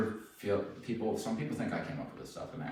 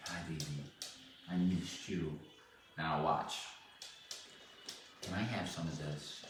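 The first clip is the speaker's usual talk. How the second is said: soft cooing to a dog; 3 semitones lower, 8 dB quieter than usual. Real, not acted.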